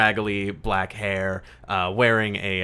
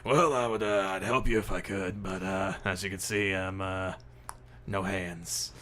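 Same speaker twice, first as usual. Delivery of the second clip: ghost Marcus. Acted. Ghost can't help pick up pencils, mildly wry. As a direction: make a halting, wry excuse. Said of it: "like, Marcus".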